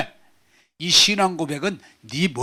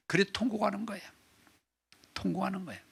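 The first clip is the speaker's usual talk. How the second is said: hushed sorrow; 4 semitones higher, 12 dB quieter, much faster than usual. Acted. Describing Peter weeping.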